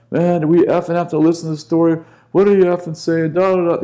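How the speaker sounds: loud, high, rushed mock complaining; griping teens. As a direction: imitate fed-up kids heckling the storyteller. Mocking delinquents' impatient complaints.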